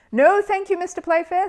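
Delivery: high, loud, firm refusal. A narrator's reading. Paddington refuses host's offer, firm and loud. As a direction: refuse politely but firmly. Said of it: "said Paddington firmly".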